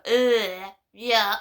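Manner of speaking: mock disgust. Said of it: "the neighbours trigger me and go".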